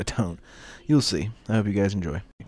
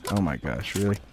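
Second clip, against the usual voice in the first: normal talking voice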